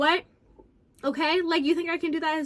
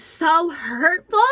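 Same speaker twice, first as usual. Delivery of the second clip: drawn-out, louder, sarcastic so hurtful; mock hurt; 3 semitones higher, 6 dB louder, much slower than usual. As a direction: stretch it out to mock him. Mocking his complaint.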